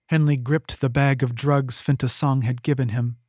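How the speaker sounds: ordinary delivery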